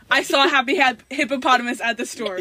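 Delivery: loud, triumphant full-phrase guess; yelling. Real, not acted. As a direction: shout it out as if over music. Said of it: "You're yelling".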